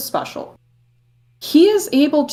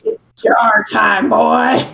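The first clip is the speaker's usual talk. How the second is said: slow, louder proclamation; mock announcer; 5 dB louder, slower than usual. Teasing co-hosts with the jar joke, mock announcement. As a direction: announce it like a show host.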